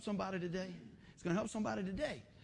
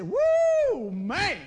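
loud high whoop dropping into "man"; hammed-up wolf-whistle kind of holler; admiring whoop; 11 semitones higher, 12 dB louder than usual. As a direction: burst out in exaggerated delight. Whoops in admiration of his wife.

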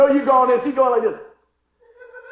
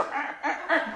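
higher-pitched croaking car noise; imitated frog croak; 5 semitones higher, 4 dB quieter, faster than usual. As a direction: croak it out for the mechanics. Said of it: "makes a noise like a frog".

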